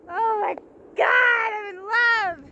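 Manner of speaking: nearly crying from happiness; real happy tears; tearful joy. Real, not acted. I'm not gonna cry.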